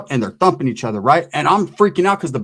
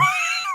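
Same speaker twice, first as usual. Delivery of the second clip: high burst of laughter; 7 dB quieter than usual. Real, not acted. Laugh after buddy quote.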